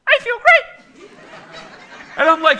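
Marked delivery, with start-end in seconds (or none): 0.1-1.1 very high, loud talking-toy voice; high-pitched mock toy catchphrase; Sleep and Snore Ernie. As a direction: squeal it slowly like a talking doll. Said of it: "And then you hear".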